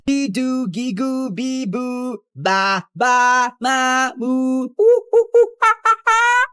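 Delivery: high babbling to drown her out; sing-song babble, rising to squeals; teasing baby babble. Acted. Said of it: "I'm not listening to your rant".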